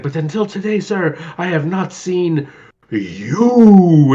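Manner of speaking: hammed-up stage reveal; dramatic Gomez. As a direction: land the last word like an accusation. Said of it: "He's like".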